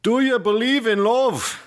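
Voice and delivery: high-pitched, German-tinged Pennsylvania Dutch; Pennsylvania Dutch accent. Shown as a demonstration. Co-host attempting lyrics for fun, Pennsylvania Dutch accent. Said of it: "I could do a Pennsylvania Dutch accent".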